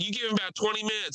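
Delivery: fast, high-pitched loudmouth passenger; mock announcing. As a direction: rattle it off to the rows around you. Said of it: "he's announcing this shit".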